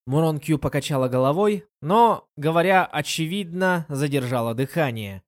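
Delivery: usual speaking voice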